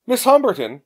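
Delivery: high-pitched call through a door. A narrator's reading. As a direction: call out her name to someone behind a door. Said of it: "came a voice through the door".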